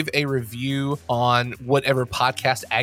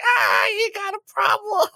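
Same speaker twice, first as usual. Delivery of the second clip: Iago voice. Retold or imitated. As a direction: yell it like the cranky cartoon parrot Iago. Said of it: "You gotta do the voice every time".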